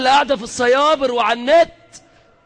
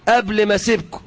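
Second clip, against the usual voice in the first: ordinary talk, nothing marked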